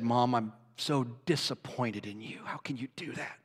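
quiet, fading to a whisper of disappointment; hushed reproach. Retold or imitated. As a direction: let the reproach sink to a whisper. Retelling scolding his mother.

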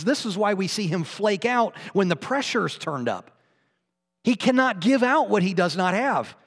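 fiery sermon climax; building preaching. Real, not acted. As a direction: preach it with rising force. Preaching point at full force.

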